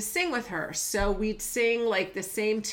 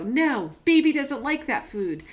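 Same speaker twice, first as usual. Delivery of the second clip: imitating daughter; 6 semitones higher, 6 dB louder than usual. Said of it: "and she'd go".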